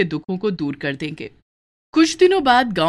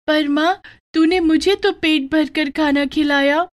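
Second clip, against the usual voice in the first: put-on higher child's voice, gently coaxing; a young girl soothing her crying mother; little daughter consoling; 8 semitones higher, 3 dB louder than usual.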